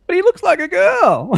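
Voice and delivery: put-on high, loud child's protest; imitated little kid. Little kids at school object to "dad", loud kid voice. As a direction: pipe up like a puzzled kid. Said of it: "the other little kids go".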